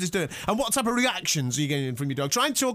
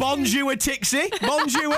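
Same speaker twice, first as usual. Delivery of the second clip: high, playful French greeting; singsong French hello to a pet; French pet talk; 7 semitones higher, slower than usual. Pretend. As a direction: greet the cat in mock French. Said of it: "but in French".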